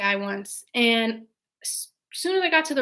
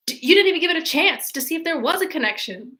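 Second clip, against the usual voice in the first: pitched up, aggrieved whine; whiny complaint; 6 semitones higher, 3 dB louder than usual. Retold or imitated. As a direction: complain like a hurt date. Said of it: "And he's like".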